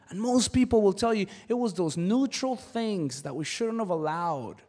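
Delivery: ordinary talk, nothing marked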